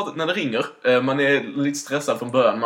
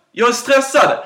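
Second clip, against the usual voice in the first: repeating it higher and louder; raised repeat; 11 semitones higher, 7 dB louder, slower than usual. Retold or imitated. Repeating himself to his mom on a bad line, raised voice. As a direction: say it again, louder this time. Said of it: "Varför skriker du?".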